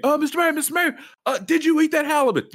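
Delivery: high mock reporter calling out; pushy reporter. Hammed-up reporter hounding the mayor about fish. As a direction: shout a question at the mayor. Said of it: "He was like".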